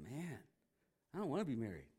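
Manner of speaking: quiet, low reluctant aside; deflated mock grumble; mock dismay. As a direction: say it under the voice, comically put off. Acting the disciples' dismayed grumble.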